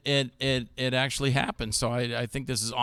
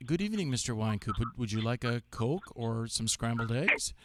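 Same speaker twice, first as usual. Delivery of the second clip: softer, courteous butler-like voice; polite gentleman; 4 semitones lower, 7 dB quieter than usual. Retold or imitated. Imitating Rod offering Joel a Coke, softly polite. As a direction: play the gracious servant. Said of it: "Rod is such a gentleman on the set".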